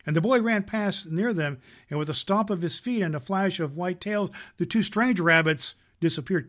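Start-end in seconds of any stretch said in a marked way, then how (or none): none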